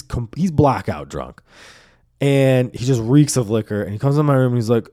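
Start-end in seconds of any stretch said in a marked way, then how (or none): none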